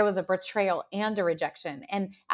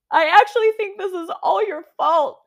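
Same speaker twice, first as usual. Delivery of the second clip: high, loud burst, reliving the moment she blamed her mom; blurted accusation; 12 semitones higher, 11 dB louder than usual. Retold or imitated. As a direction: throw the blame out suddenly, much higher and louder. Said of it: "I literally said that to her".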